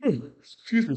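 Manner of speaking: talking through a yawn. Real, not acted. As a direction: yawn mid-sentence. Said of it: "sorry for the yawn".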